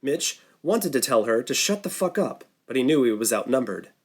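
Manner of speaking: usual speaking voice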